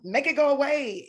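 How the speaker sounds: high, pushed pep talk to yourself; urgent self-coaching. Retold or imitated. Comedian telling herself to hold back tears, urgently.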